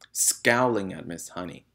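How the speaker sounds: ordinary delivery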